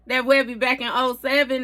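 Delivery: ordinary talk, nothing marked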